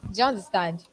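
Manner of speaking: ordinary delivery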